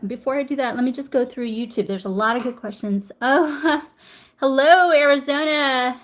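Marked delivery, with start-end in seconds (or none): 3.2-6.0 excited greeting. Real, not acted. Greeting a viewer by place.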